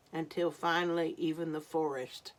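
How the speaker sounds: plain reading voice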